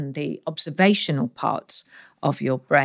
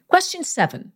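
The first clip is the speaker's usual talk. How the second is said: excited high announcement; 12 semitones higher than usual. Acted. Host announces next question.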